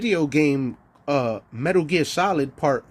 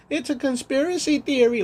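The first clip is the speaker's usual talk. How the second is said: mocking whiny voice; 10 semitones higher than usual. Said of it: "So you rather just say".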